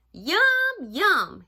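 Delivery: high, loud, relishing mouse thought; gleeful anticipation. Acted. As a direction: savor it like a hungry little mouse. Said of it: "he thought".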